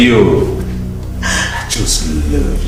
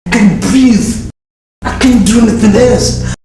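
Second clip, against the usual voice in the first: breathless outburst; 10 semitones higher than usual. Acted. Obsessed man overcome by desire.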